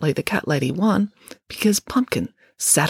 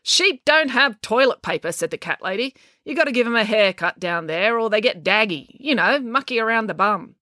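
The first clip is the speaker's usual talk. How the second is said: put-on higher-pitched, matter-of-fact old lady; lightly voiced blunt cat lady; 6 semitones higher than usual. A narrator's reading. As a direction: explain it bluntly, a touch impatient.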